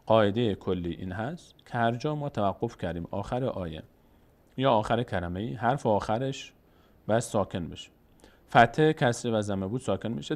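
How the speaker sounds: ordinary talk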